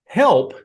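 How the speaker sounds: help punched high and loud; stress on help. Shown as a demonstration. Appraiser stressing the word to viewers, help said high and loud. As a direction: lean hard on the word help. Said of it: "wanna emphasize that word".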